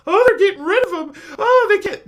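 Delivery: high, loud laughing mockery; mocking laugh. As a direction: laugh at them while quoting. Mocking quoted officials, laughing.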